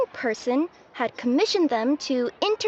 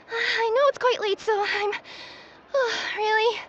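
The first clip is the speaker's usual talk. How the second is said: flustered apology; 6 semitones higher than usual. Acted. Late apology.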